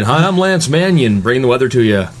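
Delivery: mock weather anchor greeting; weatherman voice. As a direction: sell it like a cheesy local weather guy. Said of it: "I really bring it in".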